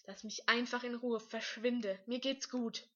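loud, insistent demand; angry outburst. A narrator's reading. Cornered boy tells his rival to vanish, loud and angry. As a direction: raise your voice and push them away.

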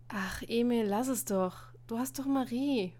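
usual speaking voice

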